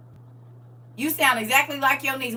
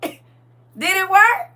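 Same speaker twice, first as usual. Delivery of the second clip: mock suspense; 7 semitones higher, 7 dB louder, much slower than usual. Shown as a demonstration.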